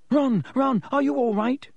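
high, alarmed squeal; high-pitched panicked squeal. A narrator's reading. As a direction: squeal his name in panic. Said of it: "squealed Hermione".

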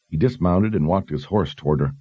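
ordinary delivery

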